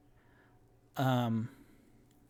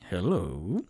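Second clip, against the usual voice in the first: deep greeting; 5 semitones lower than usual. Acted. Towering half-orc greets.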